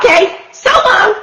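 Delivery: high, chirpy farewell; cheerful goodbye. Acted. Rosie cheerfully says goodbye to Hank, high and bright. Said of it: "said Rosie cheerfully".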